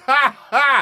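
high, loud forced laugh. Acted. Son breaking bad news to mom, laughing it off. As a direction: play it off with a big fake laugh. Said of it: "had to kinda laugh it off".

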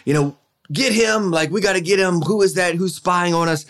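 high, urgent calling out at the stands; alarmed shout. Retold or imitated. Coach spots a spy in the stands, high alarmed call. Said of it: "and said".

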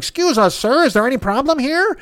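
put-on voice of an officious do-gooder; mocking imitation of eager, polite meddlers; mock Cub Scout. Two passengers butting into the fight, mock Cub Scout. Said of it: "two fucking Cub Scout idiots".

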